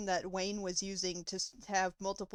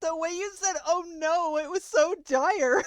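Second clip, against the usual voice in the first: laughing; 7 semitones higher, 8 dB louder than usual. Real, not acted. Laughing while teasing friend.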